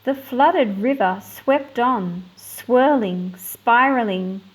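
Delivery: put-on measured read-aloud storytelling for children; story narration. Teacher reading a picture book to her class, storytelling voice.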